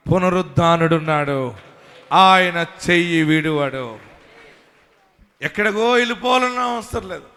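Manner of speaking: fiery sermon build; full-force preaching. Acted. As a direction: preach it out to the whole church.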